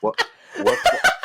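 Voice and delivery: laughing. Acted. Teasing Gabe about a leak.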